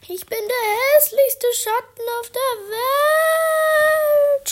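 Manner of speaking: very high, loud and slow sobbing wail; crying shout. Acted. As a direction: wail it through tears. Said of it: "schrie er".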